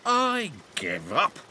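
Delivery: weary engine voice. A narrator's reading. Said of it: "clattered Jack".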